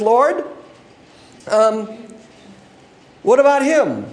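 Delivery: a put-out disciple demanding fairness; indignant Peter. Acted. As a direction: point at John and complain.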